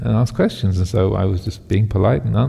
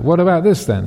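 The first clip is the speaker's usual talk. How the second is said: higher cruel taunt; taunting king; 8 semitones higher than usual. Acted. King taunting the yogi before cutting again, higher cruel jeer. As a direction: goad him with the next cut.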